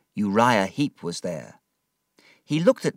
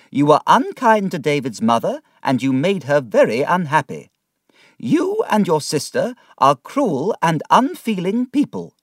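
put-on higher, louder indignant old aunt; scolding aunt; 8 semitones higher, 7 dB louder than usual. Aunt scolding Mr Murdstone for his cruelty, stern and loud.

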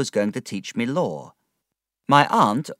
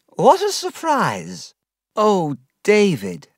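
put-on higher, slow, startled girl's voice; gently shocked at seeing him; surprised young woman; 10 semitones higher, 5 dB louder, slower than usual. A narrator's reading. Agnes voiced meeting drunk David.

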